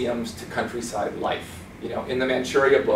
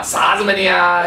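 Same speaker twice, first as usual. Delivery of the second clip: "啥子嘛你啊?" loud, high and sharp in Sichuan dialect; sharp Sichuanese; 6 semitones higher, 6 dB louder than usual. Shown as a demonstration. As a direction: bark "啥子嘛你啊?" like a Sichuan local. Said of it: "very sharp and direct".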